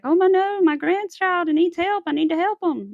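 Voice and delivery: high, loud worried outcry; mock panicked grandparent. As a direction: imitate a grandparent frantic to help. Acting out a panicked older person.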